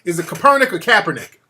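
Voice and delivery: first Kaepernick as "kuh-PER-nick", second as "CAP-er-nick"; loud either-or question on where Kaepernick takes its stress; contrasting pronunciations. Shown as a demonstration. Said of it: "How do you pronounce it?".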